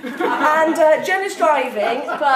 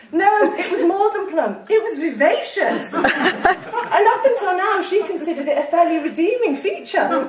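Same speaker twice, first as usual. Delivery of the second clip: laughing; 5 semitones higher than usual. Real, not acted. Reader laughing, pitch up.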